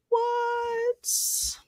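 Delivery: high disbelieving what; stunned high pitched what; shocked. Retold or imitated. Shocked reaction to phone call.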